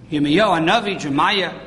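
normal talking voice

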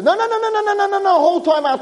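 high, frantic string of no's; pleading good angel. Acted. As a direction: stop the verdict, begging fast. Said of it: "the good angel says".

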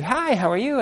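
high sing-song hello, playing the ex-wife; mock woman's greeting. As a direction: pitch way up for a sweet hello.

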